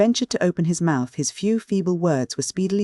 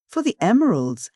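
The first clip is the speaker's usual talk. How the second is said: higher, mocking repeat of his words; scornful echo; 7 semitones higher than usual. A narrator's reading. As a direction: throw his words back at him with scorn. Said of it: "echoed the lady scornfully".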